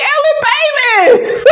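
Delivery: very high, stretched-out squeal of delight; gleeful cheering. Retold or imitated. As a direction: cheer her on slowly, pitch way up.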